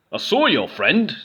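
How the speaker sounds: lightly voiced troll; a hint of a lumbering troll. A narrator's reading. Troll answering Goblin, lightly voiced troll. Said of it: "said the troll".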